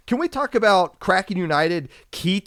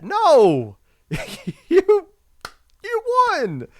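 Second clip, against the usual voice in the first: much higher and louder, disbelieving; incredulous; 13 semitones higher, 5 dB louder, much slower than usual. Real, not acted. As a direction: shout it in disbelief. Incredulous rebuttal, laughing.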